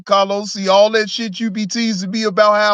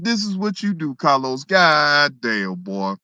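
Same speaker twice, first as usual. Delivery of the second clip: ordinary delivery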